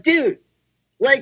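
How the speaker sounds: mock yelling at the television; retold yell at TV. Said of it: "I was also yelling at my T V".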